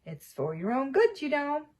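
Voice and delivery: a touch of false kindness; saintly voice. Acted. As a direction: fake concern, sweetly sly. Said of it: "in a saintly voice".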